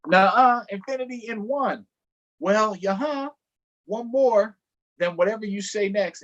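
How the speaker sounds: high-pitched, one-upping squabble; childish bickering. Acted. Story animals arguing over who was the best choice, bickering. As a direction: pitch it up and argue back like a kid.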